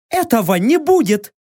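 high, firm warrior woman's voice; a proud captive bargaining; proud female warrior. Acted.

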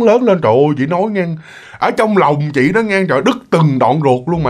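usual speaking voice

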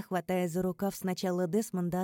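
plain reading voice

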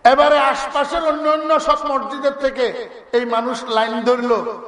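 ordinary talk, nothing marked

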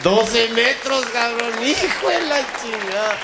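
slow, loud, high yell; loud punchline shout. Acted. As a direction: bellow the punchline, stretching it. Remate exagerado a gritos. (grito lento y fuerte del remate)

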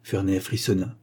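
ordinary delivery, nothing marked

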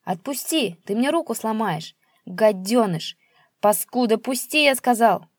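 put-on old man squealing at his son to let go; shrieking. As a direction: screech it as your arm is twisted. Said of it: "заверещал отец".

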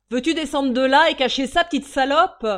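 injure criée, haute et forte; furieux. Acted. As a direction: chasse la môme de la table. Garçon scandalisé apostrophe la môme. (colère criée très fort)

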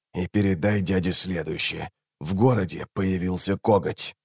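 soft, confidential message; hushed warning. A narrator's reading. Assassin gives a boy a message for his uncle, hushed. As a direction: pass it on quietly, voice held down.

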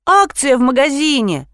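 high, protesting excuse; defensive. A narrator's reading. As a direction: fend off the accusation. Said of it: "начала отбиваться Лизавета". (оправдываясь, высоким голосом)

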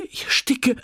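high choking cry; a panicked outcry, jumping up from the table; desperate cry. A narrator's reading. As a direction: cry out that she can't breathe. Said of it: "schrie sie und sprang auf".

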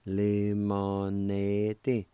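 very slow, drawn-out Lemonade said lemoneti; teacher slowly modeling Lemonade; slow demo. Shown as a demonstration. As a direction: stretch Lemonade out. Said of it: "is pronounced like this".

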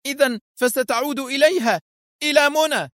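very high, indignant question; outraged. A narrator's reading. Wife demands if he returns to Mona, outraged. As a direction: erupt in high-pitched jealousy. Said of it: "قالت في ثورة".

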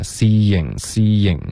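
"seeing" as see-ying, two syllables; added y glide. Shown as a demonstration. Teacher models the real pronunciation, y glide in seeing. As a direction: slip a light y into the middle of "seeing". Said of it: "一个很轻的耶的音添加进去".

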